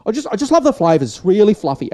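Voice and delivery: high, loud gushing praise; mock judge gushing. Vlogger imitating a TV judge, mock gushing. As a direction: gush over the pie like a TV judge. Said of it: "He'll be like that".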